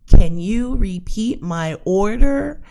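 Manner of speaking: loud and slow, over-enunciated; loud deliberate drive-through order; overly loud slow order. Retold or imitated. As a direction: speak up slowly like to a bad speaker.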